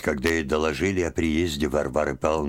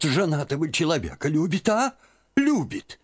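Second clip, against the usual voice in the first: put-on old aunt's scandalised cry, the last Любит much higher; outraged exclaim; 10 semitones higher than usual. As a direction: throw up your hands at the shocking news. Old aunt outraged at Liza's love.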